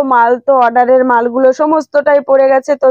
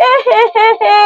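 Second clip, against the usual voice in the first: silly clown; 6 semitones higher than usual. Pretend. Toy truck falls over.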